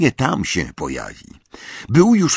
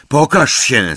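calling out; 6 semitones higher, 5 dB louder, slower than usual. A narrator's reading. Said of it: "zawołał".

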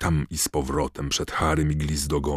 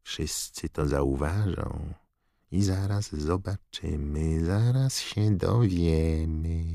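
cold Dark Lord; slower than usual. Acted. Voldemort muses menacingly.

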